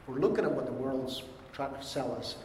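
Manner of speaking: usual speaking voice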